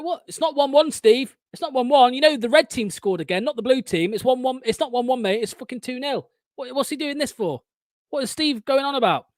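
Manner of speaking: high-pitched, exasperated retelling; incredulous protest. Retold or imitated. Fan re-enacting his reaction to Steve, incredulous.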